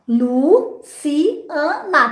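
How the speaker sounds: "Luciana" very slowly, broken into pieces; halting count through "Luciana"; counting out the name. Shown as a demonstration. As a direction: separate "Luciana" with gaps, Lu, ci, a, na. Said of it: "Ele vai contar".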